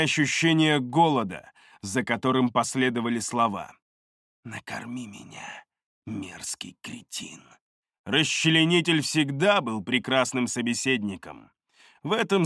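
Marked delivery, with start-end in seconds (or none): 4.4-7.4 very soft, low, slow demand; whisper-quiet voice of a malevolent blade; hungry knife spirit. A narrator's reading. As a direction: drag out the insult, hushed and low. The hungry knife speaks in words.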